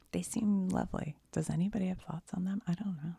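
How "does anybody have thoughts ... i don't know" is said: usual speaking voice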